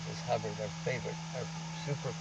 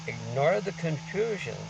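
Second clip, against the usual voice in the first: slow emphatic quote; 4 semitones higher, slower than usual. Acted.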